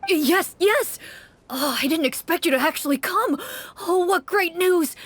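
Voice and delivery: relieved townsman gushing at the hunters; overjoyed. Acted. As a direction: spill over with relief and excitement.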